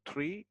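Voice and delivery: "tree" with a t sound opening it; "tree" as a demonstrated variant; alternative "three" pronunciation. Shown as a demonstration. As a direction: say "tree", t then r, as a model. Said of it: "atau tree bisa".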